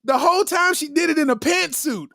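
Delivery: normal talking voice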